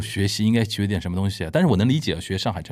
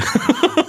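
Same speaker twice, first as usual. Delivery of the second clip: sudden high-pitched laugh; high burst of laughter; 3 dB louder than usual. Real, not acted. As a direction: break into a bright laugh. Amused at classroom of foreigners.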